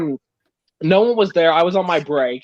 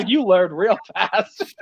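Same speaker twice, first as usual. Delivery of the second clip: slow words broken by laughter; laughing; much slower than usual. Retold or imitated. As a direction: laugh through a slow, smug line. Mocking a customer, laughing.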